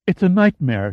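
ordinary delivery, nothing marked

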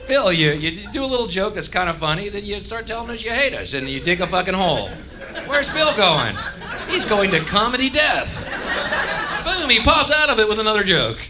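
high-pitched running commentary; put-on audience voice. Audience narrating Bill's slide into comedy death, high mock voice. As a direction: follow his act aloud in a high, excitable voice.

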